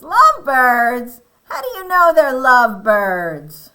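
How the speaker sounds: high, very loud storybook Elephant; incredulous. Acted. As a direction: challenge it in loud disbelief. Elephant challenges Piggy in story.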